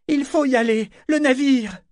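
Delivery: high, pressing cry; urgent calling. A narrator's reading. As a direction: urge him away before it is too late. Urging him back to ship. (appel pressant, voix aiguë)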